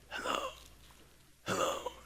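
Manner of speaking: voiceless, very soft imitation of lost voice; blown-out voice. Preacher showing his voice after getting home, blown-out. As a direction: barely get the word out, no voice left. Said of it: "I blew out my voice".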